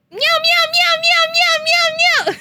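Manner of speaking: put-on very high, loud cat-girl meows; high cutesy meows. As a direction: squeal them like an anime cat girl.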